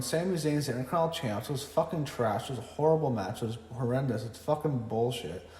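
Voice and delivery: usual speaking voice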